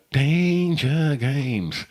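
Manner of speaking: very slow, stretched-out catchphrase; drawn-out demonstration. Shown as a demonstration. Showing co-host how it goes, very drawn out. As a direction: draw each word right out, very slowly. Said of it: "How's that go again".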